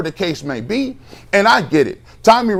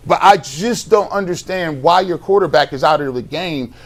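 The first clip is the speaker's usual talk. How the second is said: normal talking voice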